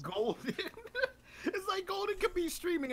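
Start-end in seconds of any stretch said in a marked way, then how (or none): 0.6-2.9 high, laughing through the words. Real, not acted. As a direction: giggle it out. Laughing while speaking.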